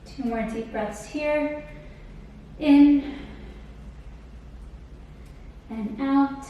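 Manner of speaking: calm cool-down guidance. Acted. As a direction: ease them into the stretch. Leads the cool-down stretch.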